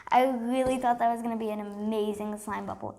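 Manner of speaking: ordinary delivery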